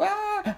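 repeated high-pitched cries; acted high screams; yelping in pain. A narrator's reading. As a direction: yelp as if the finger burns.

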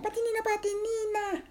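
very high, squeaky character voice; tiny high-pitched voice; excited little creature. Acted. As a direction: squeal a friend's name with delight. Hugging friend calls her name.

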